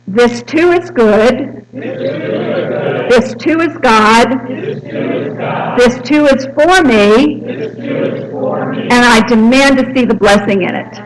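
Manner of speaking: a bold prayer spoken slowly and loud, to lead the room; bold, audacious declaration. Shown as a demonstration. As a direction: say it like you demand the blessing. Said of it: "very boldly and audaciously".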